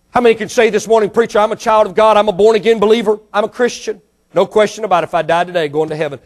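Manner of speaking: loud, high, full-force preaching; fiery preaching. Acted. Preacher warning his congregation, fiery full-force preaching. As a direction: thunder the warning at the pews. Calls for raised hands.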